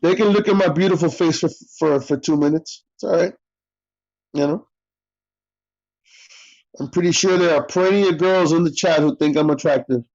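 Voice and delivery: dry sarcastic brag. Acted. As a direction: boast it sarcastically. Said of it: "Being sarcastic right now".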